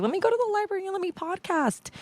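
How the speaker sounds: high squeaky voice, playfully mocking her own words; squeaky mock self-quote. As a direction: pitch it way up like a cartoon version of yourself. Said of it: "So I was like".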